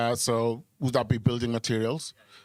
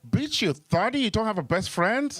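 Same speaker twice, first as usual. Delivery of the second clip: mock exasperated retort; 7 semitones higher than usual. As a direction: snap at her in disbelief. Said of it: "I'm like".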